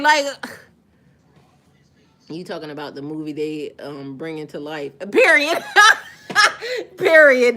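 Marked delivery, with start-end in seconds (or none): 5.1-7.6 loud laughing "Period". Real, not acted. Vlogger laughing loudly at a viewer's comment. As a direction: burst out laughing on the word.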